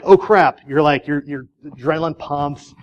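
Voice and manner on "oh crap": quick, higher-pitched "oh, crap"; offhand mild alarm. Acted. Driver reacting to seeing a deer, offhand mild alarm. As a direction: toss out a light, high "oh, crap". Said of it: "you're not like".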